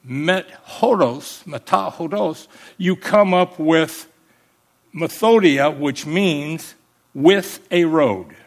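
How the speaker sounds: slow, spelled-out Greek teaching; teacher slowly building methodia from met and hodos. Shown as a demonstration. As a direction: lay out each Greek part slowly. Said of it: "when you put these two words together".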